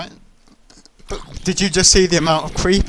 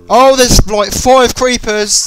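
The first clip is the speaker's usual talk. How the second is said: alarmed shout; 9 semitones higher, 11 dB louder, much slower than usual. Real, not acted. Swarm of creepers appears.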